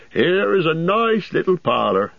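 high, bustling landlord voice; a cheery host bending down to greet; jolly innkeeper. A narrator's reading. Landlord shows parlour.

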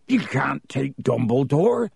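slow, rough protest, no real volume; yelling Hagrid. A narrator's reading. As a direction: protest as Hagrid in a held-back yell. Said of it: "yelled Hagrid".

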